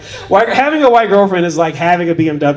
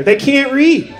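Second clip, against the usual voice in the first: ordinary talk, nothing marked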